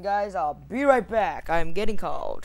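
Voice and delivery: very loud, urgent announcement; loud call-out. Real, not acted. Player telling viewers of an interruption, loud call-out. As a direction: holler it to the audience. Calling out on leaving the game.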